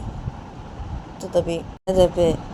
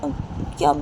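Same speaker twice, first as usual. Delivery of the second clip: put-on high, pleading little girl's voice; a hurt child begging her papa; little daughter; 11 semitones higher than usual. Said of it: "बोलती है कि". (बेटी सोनम पापा से ऊँची, मिन्नत भरी आवाज़ में पूछती है)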